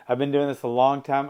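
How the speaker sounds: ordinary delivery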